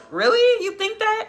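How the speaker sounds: squeaky high disbelieving delight; flattered surprise. Real, not acted. Streamer flattered by a viewer's compliment, surprised. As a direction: coo back at a compliment. Viewer says she looks young.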